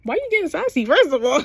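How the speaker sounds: high-pitched, swooping mock sass; sassy retort. Teasing a character about getting sassy, sassy.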